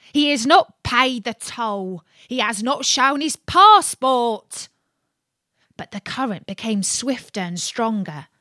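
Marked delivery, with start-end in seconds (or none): 0.1-4.7 loud, higher accusing yell; water rat yelling after the boat; rat's angry cry. Acted. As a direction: denounce the soldier as a cheat. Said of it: "as he cried to the chips".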